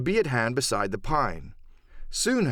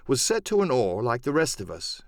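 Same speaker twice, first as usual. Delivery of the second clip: usual speaking voice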